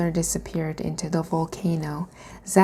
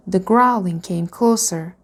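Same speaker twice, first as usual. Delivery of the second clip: ordinary delivery, nothing marked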